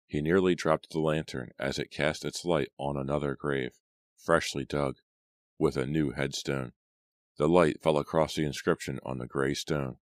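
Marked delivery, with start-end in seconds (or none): none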